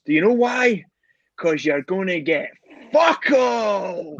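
shouting it out loud. Retold or imitated. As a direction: bellow the punchline. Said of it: "Loud as fuck".

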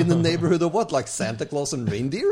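mock incredulous jab at the agent; sarcastic comeback. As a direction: ridicule her flimsy excuse.

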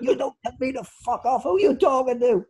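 high, affronted imitation of an older manager; Danny Begora. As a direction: tell him off in a put-on high voice, offended. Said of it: "it was Danny Begora".

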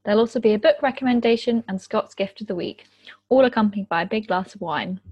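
upbeat scripted show intro; podcast intro read. Acted. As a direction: read it like a radio host.

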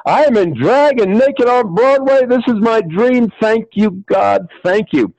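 high, elated exclamation; hammed-up ecstatic gratitude; overjoyed. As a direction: give thanks in high-pitched rapture. Chris rejoices over his dream role.

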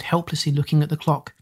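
usual speaking voice

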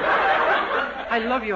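very slow, stretched-out I love you; drawn-out declaration. Acted. Dennis overdoing his love line to Miss Baker, slow drawn-out plea. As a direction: draw out every word like a mock swoon.

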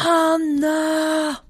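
long, very high-pitched holler; acted shout without full volume; mock all-out call. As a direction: stretch the name out high, right at her ear. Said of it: "så ropar du så högt du kan".

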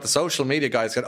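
ordinary talk, nothing marked

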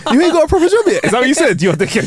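high laughing jibe; laughing tease. Real, not acted. Friend jokingly guessing what was shouted at the teacher, high laughing tease.

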